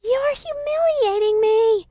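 high, loud, drawn-out whine; squealing child voice, slow and indignant; mortified little sister. A narrator's reading. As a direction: wail it slowly like an embarrassed small girl. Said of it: "she hisses, her face turning bright red".

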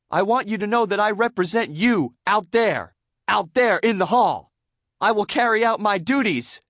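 high, loud, earnest oratory; rousing SpongeBob speech. A narrator's reading. SpongeBob pledging to classmates, rambling grandly. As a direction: promise it like a campaigning politician. Character making a pompous speech.

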